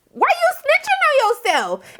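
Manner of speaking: very high, drawn-out incredulous cry; mock disbelief. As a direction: squeal it slowly, hammed-up astonishment. Incredulous reaction retold.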